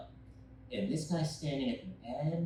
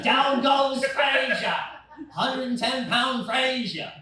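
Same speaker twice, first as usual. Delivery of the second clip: slow booming shout, higher; shouted announcer call; 9 semitones higher, 6 dB louder, slower than usual. Acted. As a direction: yell it like a ringside announcer. Repeat shouted louder.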